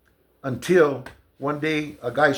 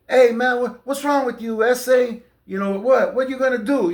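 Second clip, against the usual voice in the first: much higher and louder, whiny playing-victim voice; mock innocent protest; 9 semitones higher, 4 dB louder than usual. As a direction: imitate a bully suddenly acting wronged for the guard. The guy's sudden innocent act.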